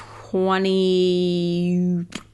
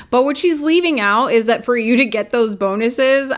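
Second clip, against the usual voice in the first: normal talking voice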